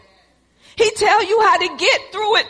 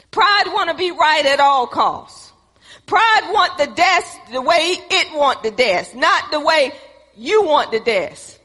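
ordinary talk

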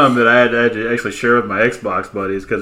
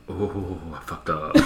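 much softer, slow, dismayed; quietly realizing he's in trouble; hushed dread; 5 semitones lower, 12 dB quieter, slower than usual. Retold or imitated. Caught gamer hearing his wife wake, hushed and slow. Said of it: "he's like".

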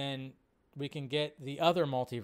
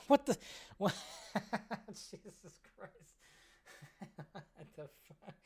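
laughing; 4 semitones higher, 6 dB quieter than usual. Real, not acted. Laughing hard at clip.

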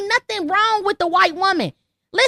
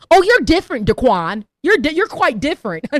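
a light sneer of fake praise; mock patronizing compliment; 4 semitones lower than usual. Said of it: "You might hear things like".

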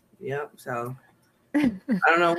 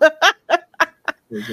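laughing; 11 semitones higher, 6 dB louder than usual. Real, not acted.

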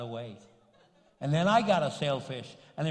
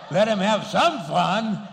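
slow, loud, higher-pitched exclamation; emphatic shout; 4 semitones higher, 10 dB louder, slower than usual. Acted. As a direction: belt it out slowly, punching each word. Exclaims his generous decision.

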